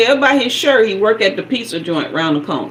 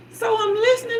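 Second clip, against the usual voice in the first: ordinary talk, nothing marked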